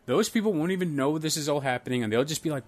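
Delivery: usual speaking voice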